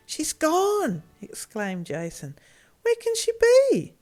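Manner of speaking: high, loud alarmed cry; sudden panic at the empty pit. Acted. Boy discovers his sister is gone, alarmed cry. Boys discover girl missing.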